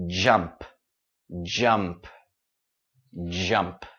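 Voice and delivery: "jump" with a clear voiced j at the start; j sound. Shown as a demonstration. Teacher modelling the j sound for students, voiced. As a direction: sound the j in "jump" buzzing, not sh.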